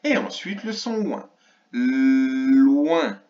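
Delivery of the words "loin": Loin with a very long, loud L before OIN; long L sound. Shown as a demonstration. Teacher showing L then ouin, long L sound. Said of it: "au début le son L de la limace".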